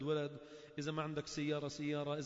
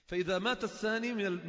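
normal talking voice

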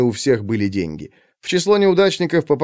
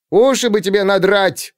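high, raised scolding yell; yelling after him; 8 semitones higher, 3 dB louder than usual. A narrator's reading. Woman yells after the fleeing boy, higher. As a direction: shout after the running boy. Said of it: "вопила ему в спину тётка".

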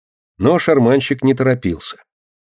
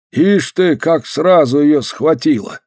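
put-on high, drawn-out old man's lament; old man's slow wondering exclamation, pitched up; 6 semitones higher, slower than usual. A narrator's reading. Old man hearing the girl's sad news, lamenting.

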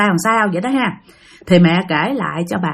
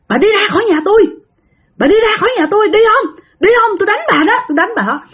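high-pitched, heated retold threat; angry threat; 12 semitones higher, much faster than usual. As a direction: act out a man ordering an intruder out, pitch way up. Husband threatens woman with broom. (giọng hăm dọa, đuổi người ra khỏi nhà)

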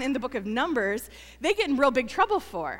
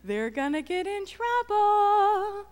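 high, slow, sing-song gloat; mock-gleeful child voice, much higher and slower; gleeful tattletale; 8 semitones higher, much slower than usual. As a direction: drag it out, delighted someone's in trouble. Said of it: "my response to this might be like".

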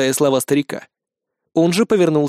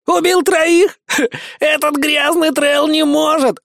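scoffing braggart; 11 semitones higher, slower than usual. A narrator's reading. Iggy mocks the slave before the crowd, high scornful voice. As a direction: sneer at the very idea, pitched up.

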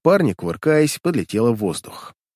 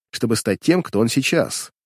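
ordinary delivery

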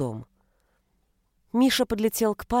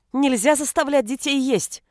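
high, raised mother's voice; insistent protest; 5 semitones higher, 4 dB louder than usual. A narrator's reading. Mother defending children from father, insistent. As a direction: keep at it, indignant. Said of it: "не унималась она".

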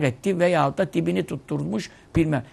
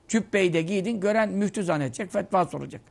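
ordinary delivery

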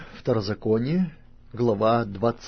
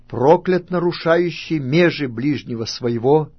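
raised, higher solemn pronouncement; loud proclamation; 5 semitones higher, 8 dB louder than usual. A narrator's reading. Levites cursing whoever reviles father or mother, loud proclamation. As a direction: proclaim it loudly as a curse. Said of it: "скажут всем израильтянам громким голосом".

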